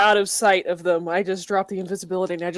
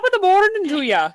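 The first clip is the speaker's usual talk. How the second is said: high, hammed-up Irish lilt; Irish accent; 11 semitones higher, 3 dB louder than usual. Shown as a demonstration. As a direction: do a cartoonish Irish greeting. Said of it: "Talk about your Lucky Charms".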